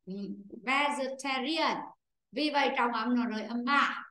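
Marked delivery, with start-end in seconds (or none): 0.6-1.8 Vegetarian, slowly syllable by syllable, stress on ta; slow and clear so the class can copy it; slow model pronunciation. Shown as a demonstration. Teacher modelling a word for pupils, slow and clear. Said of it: "Cô đọc lại này".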